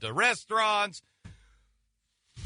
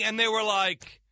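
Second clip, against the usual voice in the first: normal talking voice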